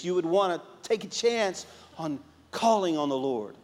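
ordinary delivery

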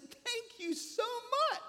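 high, slow, sing-song thank you; mock cheerful thanks. Pastor retelling his sweet reply to the atheist. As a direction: thank him sweetly as if complimented. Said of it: "And I said".

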